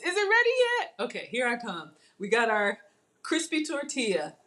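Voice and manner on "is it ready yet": put-on very high, childlike pestering question; impatient kid. Cook imitating her kids at dinner, high and pestering. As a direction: pipe up like a kid who can't wait. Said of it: "Just like the kids".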